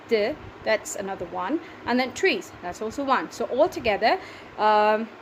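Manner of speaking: usual speaking voice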